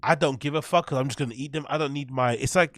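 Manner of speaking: ordinary talk, nothing marked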